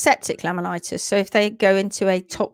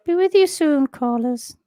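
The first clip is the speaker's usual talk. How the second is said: higher, louder sing-song operator; mock call-centre voice; 5 semitones higher, 4 dB louder than usual. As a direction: play a cheery switchboard operator for a moment. Mock call-centre hold message.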